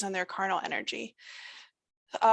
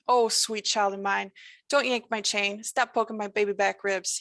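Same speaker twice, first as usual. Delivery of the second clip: ordinary delivery, nothing marked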